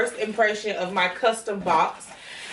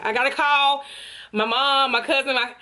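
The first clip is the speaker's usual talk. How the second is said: rising higher, bursting with excitement; can't contain the joy, climbing up; overjoyed; 5 semitones higher than usual. Real, not acted. Overjoyed after unboxing.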